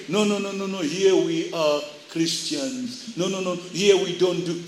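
soft, low objection from the church; meek protest. Acted. Church meekly answers the world's taunts.

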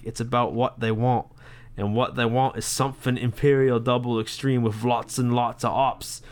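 hammed-up, bad British accent; terrible British accent. Beer drinker reading bottle label to co-host, bad British accent. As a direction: read it out in a badly faked British accent. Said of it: "That's my terrible British accent".

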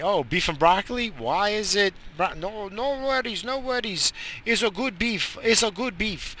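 lightly voiced cook waving off worries; shady cook reassuring a customer; restaurant owner.

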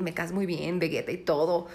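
ordinary talk

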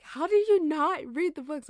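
loud, high disbelieving question; incredulous question. Retold or imitated. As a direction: ask it amazed and loud. Classmates asking in disbelief.

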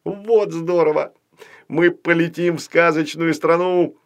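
high-pitched whoop of delight; delighted yell. A narrator's reading. Puppet hears of the dragon's invitation, yelling with delight. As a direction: cry out, thrilled to be going. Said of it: "завопил кукла Ваня и в восторге".

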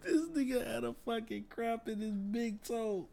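high voice breaking with laughter; cracking up mid-sentence; laughing while talking. Real, not acted. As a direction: get it out through real laughter. Still laughing at own story.

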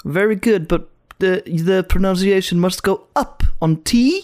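lecturer's British English, last line slowed; lightly done British accent; imitating British lecturer. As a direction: mimic the pedantic English teacher. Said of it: "så var han sån typ".

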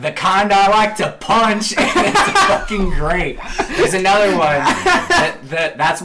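laughing punchline. Retold or imitated. Quoting Arthur's antagonize line, then laughing. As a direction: hit the line then crack up. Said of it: "Arthur's like".

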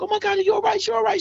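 high, worried quote of the wife, lightly voiced; alarmed wife. Wife alarmed at him crying.

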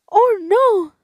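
high, loud startled "oh, no"; alarmed cry. A narrator's reading. Tortilla refuses the hungry old man, alarmed cry. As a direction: recoil in alarm. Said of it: "dijo la tortilla".

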